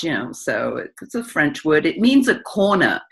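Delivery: ordinary delivery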